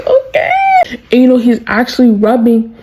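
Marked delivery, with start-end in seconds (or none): 0.0-0.5 high-pitched, drawn-out reaction; awkward okay. Retold or imitated. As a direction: squeak out an uneasy okay. Awkward reaction to back rubbing.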